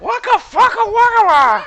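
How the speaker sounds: squawking high parrot shriek. Retold or imitated. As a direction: screech it out like an angry parrot. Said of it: "You sound like a parrot".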